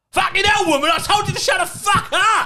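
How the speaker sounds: high, loud Cockney dad losing his temper; angry Cockney. Retold or imitated. Imitating dad yelling at mum, angry Cockney. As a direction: erupt in an imitated Cockney shout. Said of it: "he turns Cockney".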